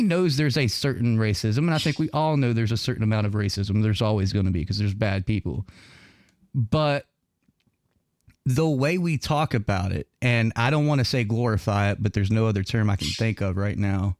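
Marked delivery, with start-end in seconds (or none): none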